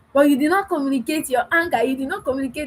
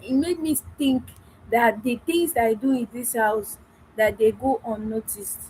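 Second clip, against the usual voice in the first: ordinary talk, nothing marked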